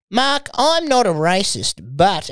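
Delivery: hammed-up talkback radio voice; mock talkback host. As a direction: drag it out like a talkback caller. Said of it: "you just hear some guy like".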